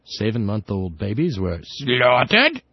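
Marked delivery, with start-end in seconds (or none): 1.6-2.6 "slaughtered" mimicked with a rising lift; imitated pronunciation. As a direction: copy his "slaughtered", punched out and swung upward. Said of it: "I just love the way he says".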